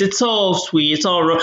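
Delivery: ordinary talk, nothing marked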